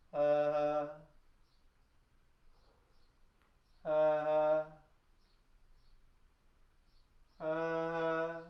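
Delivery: loud, bright open ha ha sounds; voiced ha ha. Shown as a demonstration. Teacher leading a voice warm-up, calling out ha ha loudly. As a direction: bounce sound out on each ha. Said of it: "that sunny touch of sound".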